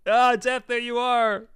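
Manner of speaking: loud, high delighted exclamation; excited cry. Real, not acted. Streamer greeting Depp's arrival on the spin, loud excited cry. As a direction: cry out as it finally turns up. Calls out as wanted result lands.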